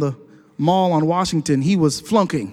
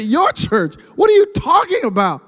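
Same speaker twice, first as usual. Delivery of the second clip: high, astonished retort; acted incredulity; 10 semitones higher, 5 dB louder than usual. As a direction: jump up in pitch in mock disbelief. Retold astonished reply to friend.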